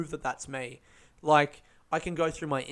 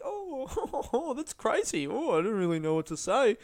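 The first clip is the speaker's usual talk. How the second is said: very high, airy nervous laugh-off; 8 semitones higher than usual. Retold or imitated. Comic laughing off a doubting punter, high nervous chuckle. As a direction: brush it off with a fake laugh. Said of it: "I tried to laugh it off".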